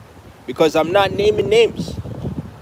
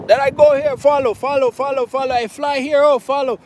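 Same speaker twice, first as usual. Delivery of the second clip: mock followers; 7 semitones higher than usual. Mocking people who follow him.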